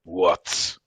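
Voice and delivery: slow, menacing challenge; a loud "what", then slow and threatening; trying to sound scary. Acted. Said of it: "trying to sound scary".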